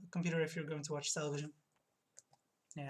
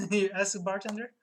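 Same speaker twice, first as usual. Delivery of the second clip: ordinary talk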